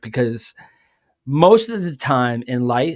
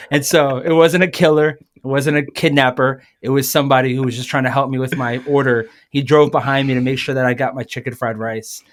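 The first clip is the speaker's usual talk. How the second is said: ordinary delivery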